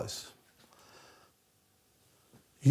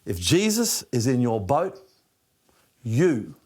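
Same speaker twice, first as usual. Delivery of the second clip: usual speaking voice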